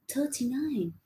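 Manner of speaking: normal talking voice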